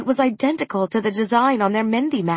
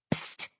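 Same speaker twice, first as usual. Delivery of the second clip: sharp whispered psst; hissed psst; 12 dB quieter than usual. Acted. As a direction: hiss it to get attention. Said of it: "hissed Minnie".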